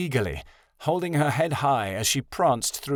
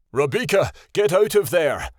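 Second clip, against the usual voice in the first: louder, calling through the trees; louder urgent call across the forest; 7 semitones higher, 5 dB louder than usual. A narrator's reading. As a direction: call her from a distance. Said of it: "Tattle's voice called through the trees".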